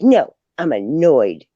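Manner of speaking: slow put-on grumpy voice; slow mock-grouchy old lady; annoyed old lady. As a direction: drag it out like a cranky old woman. Imitating Peggy.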